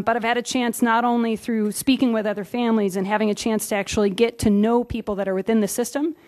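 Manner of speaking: normal talking voice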